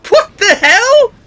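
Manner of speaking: high, loud, slow disbelief; baffled outburst. Real, not acted. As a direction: shout in total confusion. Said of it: "Oh, fuck it".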